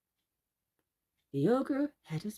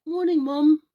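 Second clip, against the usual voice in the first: put-on polite boy; 7 semitones higher, 9 dB louder than usual. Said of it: "quite polite".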